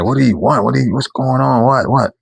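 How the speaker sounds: irritated at being shaken awake; grouchy, just woken up. Retold or imitated. Jolted awake by a tap, answering the person grouchily. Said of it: "kinda angry in a way".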